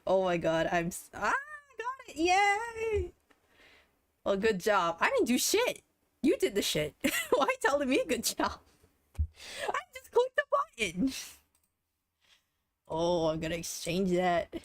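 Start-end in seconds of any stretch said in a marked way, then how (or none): 1.3-3.1 very high excited squeal; squealing joy. Real, not acted. Streamer pulling the item again, squealing joy. Second win. 5.0-11.1 high, laughing, giddy protest; giddy excitement. Real, not acted. Streamer answering chat's good job, giddy protest.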